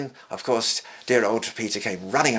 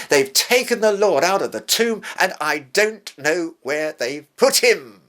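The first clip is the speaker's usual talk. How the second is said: higher, louder, urgent retelling of the empty tomb; Mary's alarmed report; 6 semitones higher, 6 dB louder than usual. Retold or imitated.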